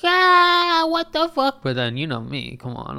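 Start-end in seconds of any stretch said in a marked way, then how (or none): 0.0-1.6 high, shrieking panic; panicked scream. Retold or imitated. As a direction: scream in terror. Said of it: "Everyone's like".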